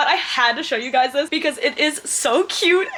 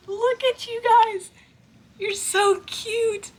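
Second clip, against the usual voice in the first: cooing at babies; 12 semitones higher, slower than usual. Real, not acted. Gushing over hatching baby birds, high excited coo.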